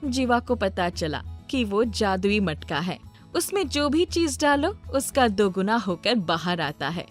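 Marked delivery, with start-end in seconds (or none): none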